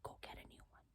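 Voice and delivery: breathy whispered aside; inner voice whisper. Retold or imitated. Her inner voice urging her to move on, whispered. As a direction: hiss it like a secret inner voice. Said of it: "another part of me is like".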